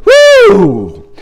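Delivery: high shouted woo of praise; joyful woo. Real, not acted. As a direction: let out a joyful whoop. Preacher exults in praise.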